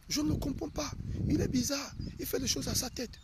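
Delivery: high-pitched mock wifely grumble; complaining wife. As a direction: pitch it up like a wife griping about her husband. Voices wife complaining about husband.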